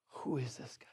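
hushed, low whisper; freaked-out disciples. Acted. Disciples after Jesus calms the storm, acted freaked-out whisper. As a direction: whisper it in stunned awe. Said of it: "are freaked out".

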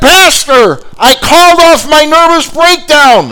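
very high, slow, full-force holler; triumphant shout. Retold or imitated. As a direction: shout the good news across the hall. Woman joyfully hollers her good news.

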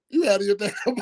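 amused re-enactment of an indignant comeback; incredulous, laughing protest. Retold or imitated. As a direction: retort in disbelief, cracking up on the last word. Retelling his indignant reply to nickname.

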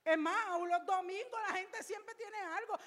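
loud, high pulpit voice; full-force preaching. Acted. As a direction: press the point home to the congregation.

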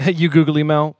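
light mock Zoolander with a laugh; Zoolander quote. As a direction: say it like a dopey model. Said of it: "why can't I say that for you".

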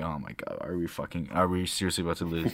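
dropped low, quietly incredulous; nervous disbelief, retold. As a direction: retell a worried thought under your voice. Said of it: "I was all nervous".

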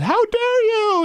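high, slow mock-outraged parent; shrill it out like an offended parent. Host mocking a touchy parent in line, mock outrage. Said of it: "a parent's".

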